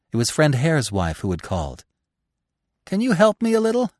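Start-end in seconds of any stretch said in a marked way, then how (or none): 2.8-3.9 high, light plea as if unhurt; almost happy. A narrator's reading. Injured hare's wife asks Bambi for help, almost happy. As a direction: ask sweetly in a high voice, untroubled. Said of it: "spoke as if she were well and whole, almost as if she were happy".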